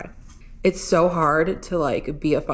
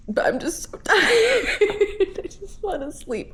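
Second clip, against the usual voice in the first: laughing through tears; 13 semitones higher than usual. Real, not acted. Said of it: "I'm literally having a meltdown".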